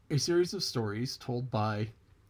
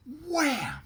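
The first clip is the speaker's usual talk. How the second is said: high, loud "wham!"; smack sound effect; 4 dB louder than usual. Acted. Narrator acts out the rabbit's smack, loud and high. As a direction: punch out the blow as a noise.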